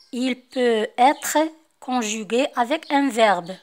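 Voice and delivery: slow, loud dictation to the class; reading the rule aloud. Acted. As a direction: spell out the rule word by word for pupils. Reads the French rule aloud. (قراءة القاعدة ببطء وبصوت مرتفع للتلاميذ)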